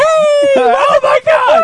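high, loud, drawn-out shout; hammed-up overjoyed greeting at full volume; excited greeting. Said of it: "Andrew's like".

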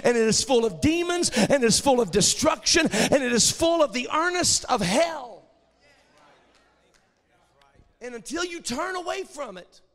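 loud, high, driving preacher's cry; full-force preaching. Acted. Preacher warning the congregation about sin, loud and driving. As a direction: hammer it home to the congregation.

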